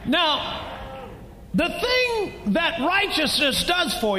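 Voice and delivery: slow, high preaching cadence. Acted. Preacher driving the point home to the church, fervent. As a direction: draw it out like a revival.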